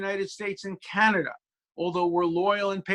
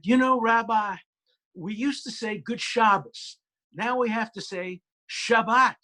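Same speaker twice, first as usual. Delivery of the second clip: put-on a congregant in a light Southern accent, pitched up; 6 semitones higher than usual. Congregant telling her rabbi about Hebrew names, mild Southern accent. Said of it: "with a deep Southern accent".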